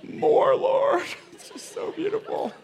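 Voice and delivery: high breathy ecstatic praise; mock worship. Pastor imitating congregants in rapture, high hushed ecstasy. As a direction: swoon like a rapt worshipper.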